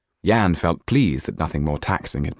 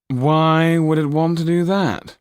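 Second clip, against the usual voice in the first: higher, louder, slow haughty question; the Marquis interrupting; 11 semitones higher, 5 dB louder than usual. Acted. The Marquis interrupting the magician, haughty, higher and louder. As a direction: cut the showman off with lofty disdain.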